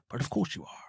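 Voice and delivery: dry whispered aside, no voice at all; whispered, barely audible retort; whispered reply. Retold or imitated. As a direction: breathe it out like a sly put-down. Quoting Connery's dry reply.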